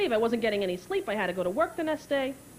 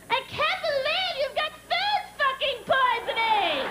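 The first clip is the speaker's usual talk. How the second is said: very high, drawn-out squeal; Melanie; 13 semitones higher, slower than usual. Retold or imitated. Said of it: "Not so easy for Melanie".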